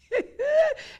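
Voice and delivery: shrieking laugh, way up high; helpless high cackle at the rival; high burst of laughter. Real, not acted. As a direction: crack up loud at the picture. Mocking rival's outfit, cracking up.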